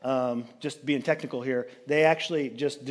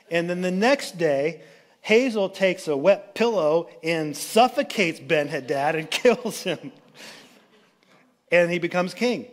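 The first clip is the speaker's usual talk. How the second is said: usual speaking voice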